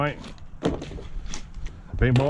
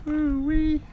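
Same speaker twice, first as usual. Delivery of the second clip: excited whoop. Real, not acted. Admiring the freshly painted truck.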